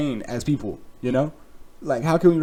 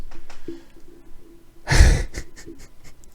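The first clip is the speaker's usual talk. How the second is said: laughing; 3 dB louder than usual. Real, not acted. Long laugh at own story.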